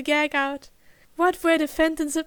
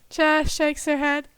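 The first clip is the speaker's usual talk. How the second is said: plain reading voice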